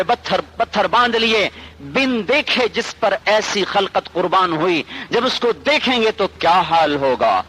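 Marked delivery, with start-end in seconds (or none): none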